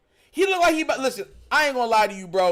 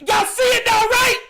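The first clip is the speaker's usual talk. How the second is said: excited shout; 10 semitones higher, 6 dB louder than usual. Real, not acted. Hyped up over the lookalike.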